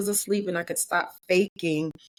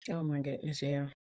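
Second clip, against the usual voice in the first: hushed; 4 semitones lower, 10 dB quieter than usual. Real, not acted. Moved, quiet reaction.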